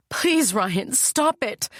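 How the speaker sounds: high, choked cry of panic; tearful plea. A narrator's reading. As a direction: beg him through tears. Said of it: "Tears choke my voice".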